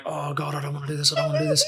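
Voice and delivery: very soft, whimpered dread; retold panic under the breath; frightened. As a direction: plead quietly, acting scared. Retold fear before being dropped.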